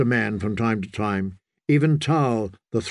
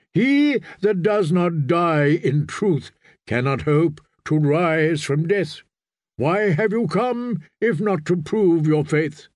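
slow, raised-pitch preaching; stern prophet; 3 semitones higher than usual. Acted. Priest challenges the riders' faith, stern and slow. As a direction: press the challenge home in measured words.

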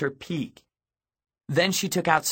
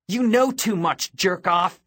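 high, raised, cutting insult; forceful threat; 7 semitones higher, 4 dB louder than usual. A narrator's reading. Girl grabs boy who knows too much, forceful. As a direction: force them along with your voice. Forcing him along through crowd.

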